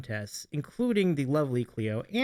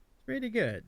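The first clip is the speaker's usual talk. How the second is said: lightly put-on Eastern European accent, pitched higher; mock Eastern European accent; 7 semitones higher, 5 dB quieter than usual. Streamer echoes the cat's Eastern European accent, mock. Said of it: "there's this Eastern European accent thing".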